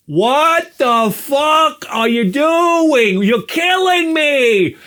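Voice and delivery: high, drawn-out furious yell; exasperated screaming. Retold or imitated. Husband blowing up at wife on phone, hammed-up yell. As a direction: blow up, stretching every word. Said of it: "why are you talking like that".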